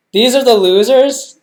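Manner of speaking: can't believe what she's seeing; shocked disbelief. Real, not acted. Still shocked at casting.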